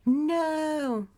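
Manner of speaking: high, drawn-out cry of refusal; shouted no. A narrator's reading. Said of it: "Margaret shouts".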